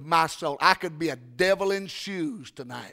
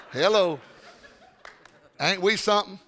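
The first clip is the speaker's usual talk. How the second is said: ordinary talk, nothing marked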